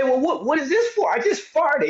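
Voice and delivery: high, wide-eyed mock innocence; baffled tenant. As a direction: play dumb and confused about the complaint. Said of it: "And you say".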